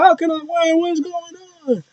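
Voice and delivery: high, bewildered mock whine; mock confused. Fan mocking Cody's confusion, high bewildered whine. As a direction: play the baffled loser. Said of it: "they're like".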